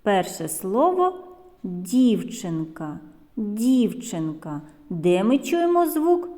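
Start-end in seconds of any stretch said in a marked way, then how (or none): 1.6-4.6 slow, careful model of дівчинка; clearly sounded out. Shown as a demonstration. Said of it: "щоб добре було чути ці звуки".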